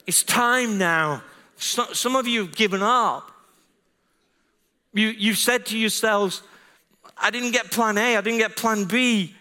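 high, urgent pulpit exhortation; forceful preaching. Real, not acted. Preacher to those who've given up, forcefully exhorting.

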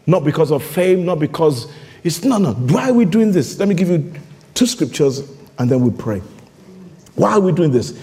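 ordinary talk, nothing marked

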